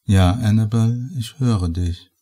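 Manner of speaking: ordinary delivery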